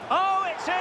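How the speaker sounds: sudden high burst of excitement; excited goal call. Real, not acted. Commentator calling the goal to the viewers, thrilled. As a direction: yell it as the ball hits the net.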